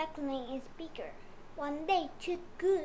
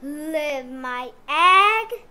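loud slow outraged question; drawn out, appalled at the idea; a mother refusing to abandon her egg; 1 shocked disbelief; 13 dB louder, much slower than usual. A narrator's reading. Friend says abandon egg.

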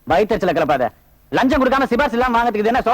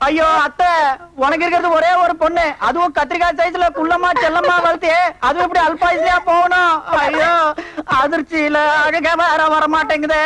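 higher-pitched hammed-up wailing; theatrical mourning, pitched up; mock lament; 5 semitones higher than usual. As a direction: wail in pretend grief. Mock lament for the dying cousin.

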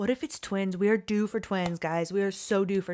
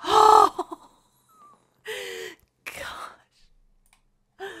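gasp; 13 semitones higher, 7 dB louder than usual. Real, not acted. Gasp at dice result.

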